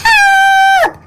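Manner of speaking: very high, loud panicked cry; cry for help. A narrator's reading. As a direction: scream for help as you slide. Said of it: "Thomas peeped".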